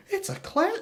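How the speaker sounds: very high imitated fan voice; high-pitched mocking praise; mock gushing. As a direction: squeal it like an overkeen fan. Mimicking everyone praising the film.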